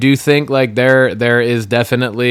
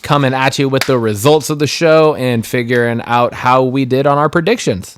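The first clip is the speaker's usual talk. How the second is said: announcer voice; 4 semitones higher, 4 dB louder than usual. Acted. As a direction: welcome the audience like a host. Opens the post show.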